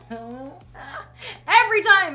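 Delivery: slow, high, laughing wail; laugh-cry in exasperation. Real, not acted.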